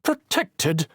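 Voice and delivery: indignant sputter on Protected. Acted. Councilwoman scoffing at Logan, indignant sputter. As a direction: spit out Protected in disbelief. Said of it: "the councilwoman sputtered".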